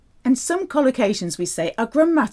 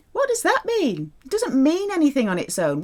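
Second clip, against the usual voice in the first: usual speaking voice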